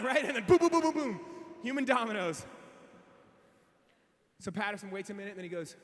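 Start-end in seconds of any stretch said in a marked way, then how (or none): 0.4-1.2 loud, high rapid booms; domino crash noises. Retold or imitated. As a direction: chain of imitated collisions. Imitates hikers colliding like dominoes.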